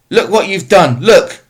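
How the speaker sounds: high, loud screaming accusation, fear under it; furious scream. Acted. Tesfaye screaming at Mamo over the fallen cow, frightened rage. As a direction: scream it at him in panic. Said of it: "screamed Tesfaye".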